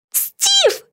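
very high cry of relief; tearful exclamation. A narrator's reading. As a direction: exclaim the name high, through sniffles. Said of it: "воскликнула Битти, громко хлюпая носом". (радостный возглас сквозь слёзы)

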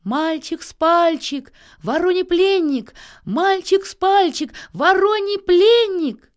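high, loud, slow chant; calling from above. A narrator's reading. Nils shouts his name down to a drake, high drawn-out call. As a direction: call it out far, stretching each word.

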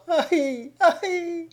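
high pitiful wail, rising; wailing cry. Acted. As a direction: cry out in mock pain. Devil wails in his plea. (gémissement aigu et plaintif)

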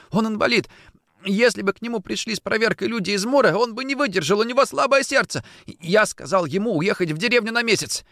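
high, urgent pleading run; desperate explaining. Acted. Orlov defending his sick uncle, desperate explaining. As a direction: plead the case, pitched well up.

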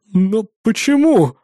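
slow, high horrified question. A narrator's reading. Engineer stopped from leaving, horrified. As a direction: ask in dawning horror, drawn out. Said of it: "ужаснулся один из них".

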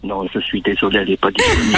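low man's voice on the phone. Shown as a demonstration. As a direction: fake a deep male voice. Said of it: "de passer de ma voix normale".

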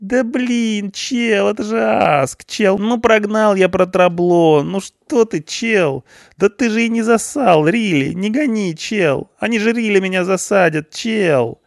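very high, nasal-free whining youth; a scared punk pleading his case; whiny punk. A narrator's reading. Punk pleads with the policeman, high frantic whine.

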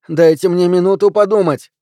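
high, loud protest; desperate plea. A narrator's reading. Suspect begging the police for time, loud and high. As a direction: plead loudly for a moment.

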